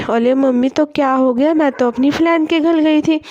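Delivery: put-on lisping child, r turned to l; small girl puzzled by the crying, lisping her r's; little girl asking her crying mother what happened, childish lisp. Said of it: "Minki".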